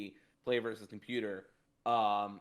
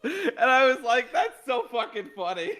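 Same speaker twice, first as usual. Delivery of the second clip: laughing; 12 semitones higher, 11 dB louder than usual. Real, not acted. Laughing while retelling the quote.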